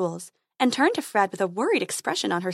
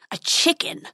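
snarling dragon; 7 semitones higher than usual. Acted. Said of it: "snarled the dragon".

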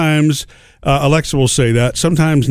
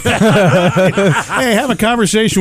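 higher, laughing request; 7 semitones higher, much slower than usual. Pretend. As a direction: giggle through the request. Joking about phone doing chores.

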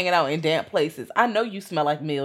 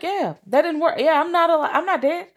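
mock cheery; 11 semitones higher, 3 dB louder than usual. Fester shrugging off death.

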